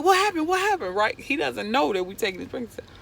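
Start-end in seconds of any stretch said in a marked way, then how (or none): 0.0-1.0 high, loud, urgent questioning; alarmed nephew. Retold or imitated. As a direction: call out worried through the door. Nephew alarmed behind door.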